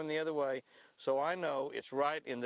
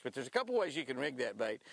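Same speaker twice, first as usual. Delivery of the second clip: ordinary delivery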